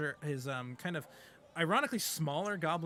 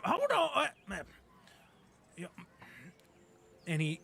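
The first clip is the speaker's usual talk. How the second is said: ordinary delivery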